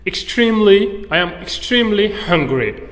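EXTREMELY pitched high and loud; emphatic. Shown as a demonstration. Teacher explains starving to learners, emphatic. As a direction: punch "extremely" high, stretching it.

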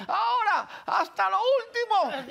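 high, drawn-out mock command; slow final push like a coach; imitated yelled orders. Tete pushes him to the last lap, imitated yelling. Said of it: "me las grita a mí".

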